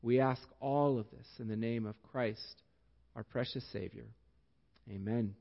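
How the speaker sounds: low, soft, measured prayer voice; hushed, reverent public prayer, sinking to a soft Amen. Real, not acted. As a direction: pray quietly, pausing between phrases. Leads closing prayer.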